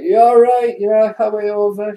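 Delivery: high, loud blokey pub greeting; cheery lad calling out at the bar; mate's greeting. Acted. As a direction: hail your mate across the bar. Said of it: "He's like".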